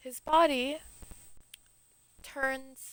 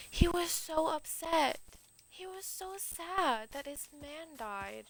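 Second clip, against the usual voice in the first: mock sorrowful; 5 semitones higher, slower than usual. A narrator's reading. Telling of Apollo's grief.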